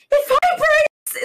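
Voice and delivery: very high, drawn-out squeal; mock excited shriek; hammed-up outburst. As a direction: squeal it slowly, way up high, imitating a cartoon. Retells cartoon character's outburst.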